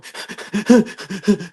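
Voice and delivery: high mock sob of a hurting child; imitated wailing sob; imitated hurt cry. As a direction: play the cry from the inside. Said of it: "that cry when you go".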